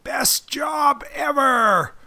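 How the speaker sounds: drawn-out high mock yell; movie-quote yell. Soldier fighting for his life in a tank, yelling. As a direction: yell it out slowly, high and stretched. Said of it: "yells out".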